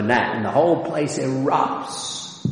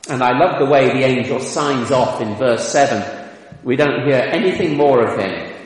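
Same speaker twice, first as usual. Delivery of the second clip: normal talking voice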